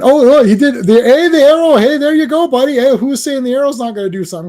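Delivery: loud, high burst of delight; excited cheering. Real, not acted. Cheering the arrow finally working.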